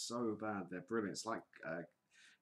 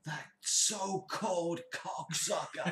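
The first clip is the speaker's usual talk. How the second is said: high, slow mock Lorne voice; a hammed-up impression, higher; imitating Lorne; 8 semitones higher, 5 dB louder, slower than usual. Podcast host quoting Lorne's line, high slow mock voice. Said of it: "and he goes".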